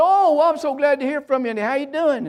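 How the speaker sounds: imitation of a mom, pitched well up; delighted mother. Mom answering her homesick son's call, high delighted greeting.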